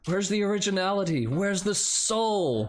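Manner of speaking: scornful judge. Acted. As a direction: lament it like a fed-up talent judge. Voices harsh judge tearing contestant down.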